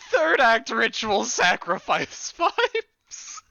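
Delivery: high, drawn-out words through laughter; laughing repeat. Real, not acted. Game master amused by a player's ritual sacrifice remark, laughing. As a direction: crack up while repeating it, slowly.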